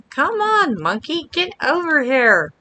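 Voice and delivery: high call to the monkey. Real, not acted. Calling the monkey.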